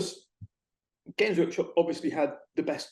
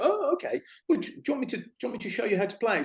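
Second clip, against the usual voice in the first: high-pitched, coaxing offer; eager shop helper; 6 semitones higher, faster than usual. Retold or imitated. Shop worker offers a newcomer a demo game, high and eager. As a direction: imitate a keen shop worker, pitched well up. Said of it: "And you go".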